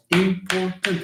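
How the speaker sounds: "important" slowly split as im-por-tant; syllable by syllable. Shown as a demonstration. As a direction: count out three syllables in "important". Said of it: "And trois syllabes".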